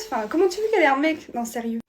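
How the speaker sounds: usual speaking voice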